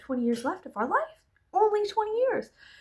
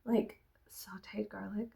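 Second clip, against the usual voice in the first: whisper; 2 semitones lower, 10 dB quieter than usual. Real, not acted. Savouring garlic.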